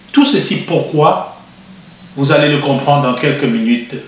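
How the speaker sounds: announcer-style opening for the viewers; TV host intro. Acted. Host introducing the show to viewers, in a presenter voice. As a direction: present the show and guest like a broadcaster.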